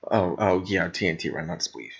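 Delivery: ordinary talk, nothing marked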